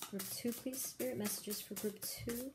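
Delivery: soft, murmured request to Spirit; quietly calling on spirit guides over the cards; hushed asking. Real, not acted. Murmured questions to Spirit while shuffling.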